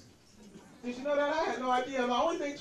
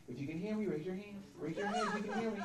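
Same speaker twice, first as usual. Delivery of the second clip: softer, gentle workshop-leader voice; soft facilitator; 8 dB quieter than usual. Retold or imitated. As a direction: coax the room quietly like a kindergarten teacher. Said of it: "facilitator standing there going".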